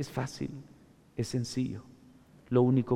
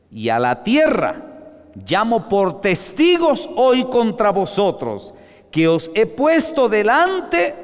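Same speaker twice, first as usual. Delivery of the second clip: higher, loud and slow warning; stern preaching; 9 semitones higher, 7 dB louder, slower than usual. Acted. Preacher warning the congregation from Scripture, stern and loud. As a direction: thunder the warning slowly. Solemn climax of sermon.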